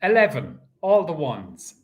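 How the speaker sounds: steady sing-song number calling; bingo caller. Acted. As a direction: call each number out to the hall. Calls the bingo numbers live.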